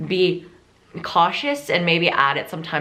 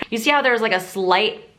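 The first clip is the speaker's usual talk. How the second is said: usual speaking voice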